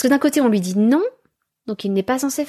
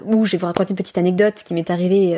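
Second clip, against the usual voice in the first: usual speaking voice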